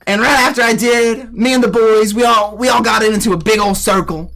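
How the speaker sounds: hammed-up country Southerner; tall-tale bragging in a thick Southern accent; Southern man accent. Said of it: "I being the real Southern man I am".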